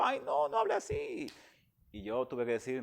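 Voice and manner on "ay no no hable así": very high, wailing mock sob; imitated crying plea. Weeping churchgoer begging the preacher not to speak so, in a high wailing mock sob. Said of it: "y personas lloraban".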